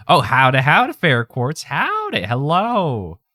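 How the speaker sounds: loud, high-pitched cowgirl howdy; excited greeting. Real, not acted. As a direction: call out delightedly to an arriving pal. Greeting a friend who just arrived.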